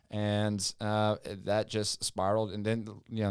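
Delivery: normal talking voice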